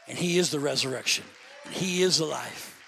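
low, loud declaring; preaching. Acted. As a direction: proclaim it with weight.

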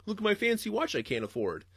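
mocking office guy. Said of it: "He's like".

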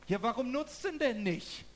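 laut und hoch, verständnislos nachgefragt; empörte Frage. Retold or imitated. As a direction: ruf verblüfft, warum er's nicht nutzt. Gespielter Dialog mit Elisa. (laute, hohe Nachfrage im gespielten Dialog)

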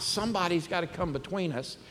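usual speaking voice

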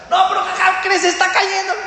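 put-on a little boy pleading to be believed; insistent child. Pupil insisting to the teacher, high urgent child voice.

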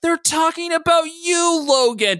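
high, loud, slow exasperated cry. A narrator's reading. Todd sets Logan straight, crying out. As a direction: cry out as if to someone clueless. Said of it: "Todd cried out".

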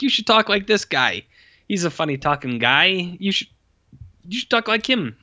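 a hint of a director coaching his actor; mock director advice.

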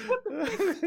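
high squeaky incredulous outburst through laughter; comic high-voiced shock; mock disbelief. Mimicking viewer's baffled reaction.

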